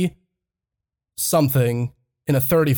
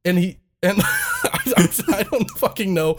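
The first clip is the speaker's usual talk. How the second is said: laughing; 3 semitones higher than usual. Real, not acted. Laughing through the retelling.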